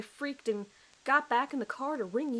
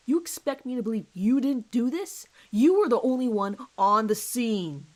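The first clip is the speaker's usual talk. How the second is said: angry accusation; 2 semitones higher, 7 dB louder than usual. Acted. Detective confronts suspect.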